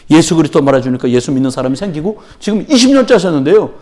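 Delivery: usual speaking voice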